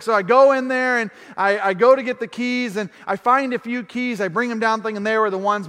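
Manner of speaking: normal talking voice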